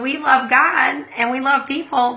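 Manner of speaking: normal talking voice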